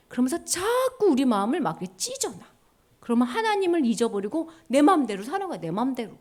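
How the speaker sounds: usual speaking voice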